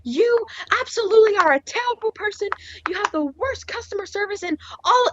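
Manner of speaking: high-pitched mock tirade; yelling customer. Customer blaming clerk for son's party, mock yelling. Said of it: "now she's yelling at me".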